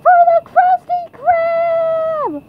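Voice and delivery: loud, drawn-out rallying cry; battle cry. Acted. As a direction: stretch it out like a charge into battle. Rallying cry echoing the boss.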